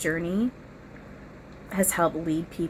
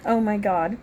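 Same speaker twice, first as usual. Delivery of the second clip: giggly; 6 semitones higher, 3 dB louder than usual. Real, not acted. Tickled again, giggly.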